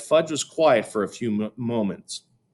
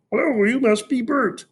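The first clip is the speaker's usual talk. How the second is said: Sesame Street voice. A narrator's reading. Fudge greeting Peter as Ernie, high puppet voice. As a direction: put on a cheerful Muppet voice. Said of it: "in his best Sesame Street voice".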